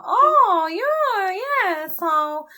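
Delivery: high, gushing mock salon chatter; mocking hairdresser voice. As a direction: send up the hairdresser, sing-song and patronising. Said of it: "sort of making fun".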